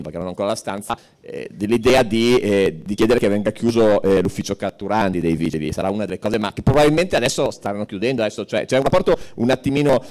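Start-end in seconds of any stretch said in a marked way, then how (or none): none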